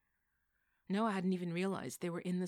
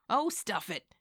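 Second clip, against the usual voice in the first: louder, higher huffy snap; huffy retort; 5 semitones higher, 11 dB louder, slower than usual. Acted. Missus J snapping at Bill Peterson, louder huffy retort. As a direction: huff it out in disgust. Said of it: "Missus J huffed".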